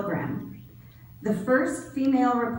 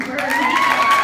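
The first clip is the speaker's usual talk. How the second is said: calling out; 7 dB louder than usual. Real, not acted. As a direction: sing her name out across the hall. Calling a graduate by name.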